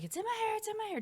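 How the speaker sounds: high, panicky mock shriek; retold panicked scream. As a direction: squeal it like something's in your hair. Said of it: "Like, I'm screaming".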